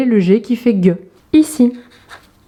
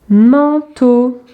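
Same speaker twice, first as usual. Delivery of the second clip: "Manteau" in two held syllables; drawn-out teacher's demonstration; 3 semitones higher than usual. Shown as a demonstration. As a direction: stretch each syllable for young readers. Exercise word for young readers.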